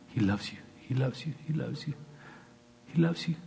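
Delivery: soft sing-along reassurance, climbing in pitch; mock cooing. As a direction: parody a sweet preacher, softly, rising each time. Mimicking love-only churches.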